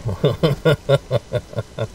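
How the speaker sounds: amused laugh. Real, not acted. Streamer amused by a pronunciation, laughing. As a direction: laugh in a rapid string.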